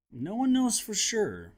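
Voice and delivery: ordinary delivery, nothing marked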